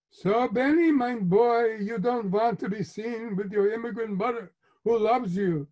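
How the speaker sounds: hammed-up Yiddish immigrant mother; put-on Yiddish accent. A narrator's reading. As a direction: lay it on in a thick Yiddish accent. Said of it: "Her accent can be as authentic".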